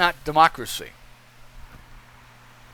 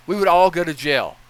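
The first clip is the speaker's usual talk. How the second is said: normal talking voice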